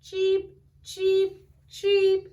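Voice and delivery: put-on high, loud baby chick chirps; chick cheeps. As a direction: peep like newborn chicks.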